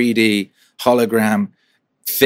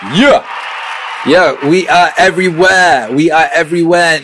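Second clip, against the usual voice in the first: hyped; 7 semitones higher, 7 dB louder than usual. Real, not acted. Host celebrating his show's reach to chat, hyped. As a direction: shout it like a victory cry.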